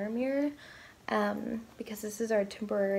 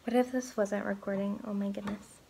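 usual speaking voice

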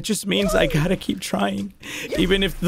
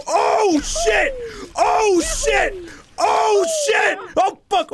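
panicked shouting; 13 semitones higher, much slower than usual. Real, not acted. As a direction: curse as you fall. Falling in game.

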